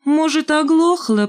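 high, slow squeak; high squeaky jab. A narrator's reading. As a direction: squeak it out spitefully. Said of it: "пропищала Шмакова".

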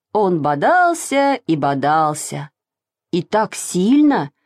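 plain reading voice

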